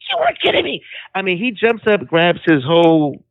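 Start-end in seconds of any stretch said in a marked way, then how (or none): 0.1-0.8 squealed high in disbelief; the son can't believe it, voice shooting way up; stunned yelp of disbelief; shocked disbelief. Retold or imitated. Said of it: "he's like".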